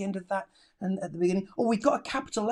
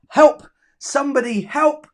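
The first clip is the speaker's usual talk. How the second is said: "Help somebody, help" said high and louder all through; acted cry for help; 5 semitones higher, 7 dB louder than usual. Shown as a demonstration. Teacher reading a pupil's sentence to the class, called out high and loud. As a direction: call the whole line out loud. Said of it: "reading pupil's sentence aloud".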